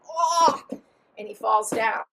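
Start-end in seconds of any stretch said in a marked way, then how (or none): 0.1-0.6 loud, high "oh" on being punched; exaggerated yelp; mock cry of pain. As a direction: play taking a punch. Punched overseer cries out.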